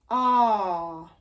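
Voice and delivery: a long, open posh English ah. Shown as a demonstration. Voice teacher showing posh English ah. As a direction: sing ah like a posh English speaker. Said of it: "It's a very kind of posh English sound".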